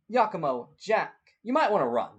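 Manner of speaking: loud, high called-out warning; urgent warning. Acted. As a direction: call out to teammates in danger. Acted warning called to teammates.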